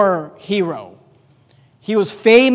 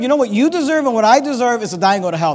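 ordinary delivery

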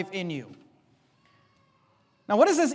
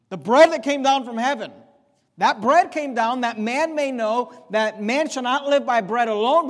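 raised, louder preaching voice, driving each phrase home; preaching at full force; 4 semitones higher, 4 dB louder than usual. Acted. Pastor driving the point home to his church, preaching at full force. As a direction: preach it hard to the congregation.

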